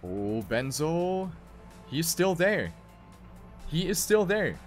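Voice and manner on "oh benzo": normal talking voice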